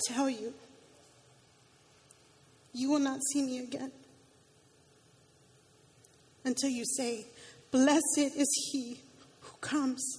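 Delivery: preacher reading Jesus' lament over Jerusalem. Acted. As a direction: let the voice sink in sorrow. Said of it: "the lament towards the end".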